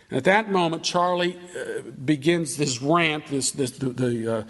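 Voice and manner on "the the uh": ordinary delivery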